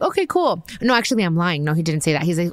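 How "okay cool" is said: squeaky high okay cool; a much higher, breezy okay cool; bright high agreeable okay cool; high chirpy okay cool. Retold or imitated. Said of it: "I'm like".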